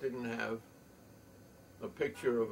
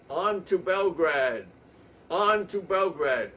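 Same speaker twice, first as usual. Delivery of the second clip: loud, high battle cry with drawn-out words; rallying shout; 7 semitones higher, 11 dB louder, slower than usual. Acted. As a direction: shout it out like a war slogan. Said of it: "and he shouts".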